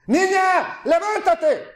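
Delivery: put-on the Messiah shouting to raise the girl; shouted command. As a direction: cry it out with full authority. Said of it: "Gritó".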